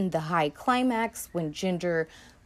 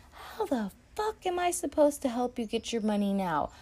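higher, exasperated mock snap; a lightly voiced fed-up gangster; imitating Nicky; 6 semitones higher than usual. Nicky brushing off Ginger, mock exasperated. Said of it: "And he's like".

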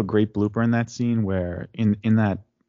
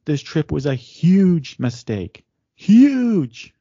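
slow, then a loud high wail on huge; exasperated Elaine; 5 semitones higher, 6 dB louder, much slower than usual. Retold or imitated. Elaine on the phone to Jerry, wailing loud in exasperation. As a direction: stretch it out, then explode on huge. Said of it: "Elaine calls Jerry from the plane".

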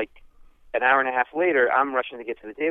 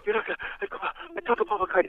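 pitched up high, giddy and stumbling; a fan who can't believe it; breathless excitement; 10 semitones higher, 4 dB quieter, faster than usual. Retold or imitated. Calling his wife from the bathroom, giddy and pitched up.

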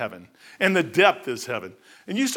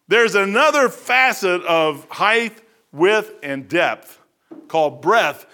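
slow, deliberate listing, each word set apart; slow emphasis; 3 dB louder, much slower than usual. Real, not acted. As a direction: lay out each dimension slowly. Preacher spells out dimensions slowly.

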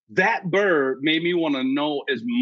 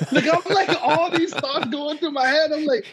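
laughing; 12 semitones higher than usual. Real, not acted. Laughing while retelling panic.

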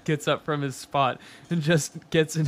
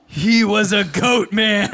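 loud, high, drawn-out proclamation; dramatic reveal; 6 semitones higher, 8 dB louder, slower than usual. A narrator's reading. Reader revealing Travis is a goat man, dramatic. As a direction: announce the monster with full drama. Big reveal in story read aloud.

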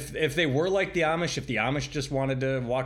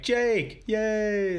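excited cheer; 8 semitones higher than usual. Retold or imitated. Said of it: "I'm like".

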